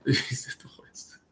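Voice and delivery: slow, soft whisper through a laugh; giggly whisper. Pretend. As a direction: breathe it out like a shared joke. Giggling teasing aside to chat.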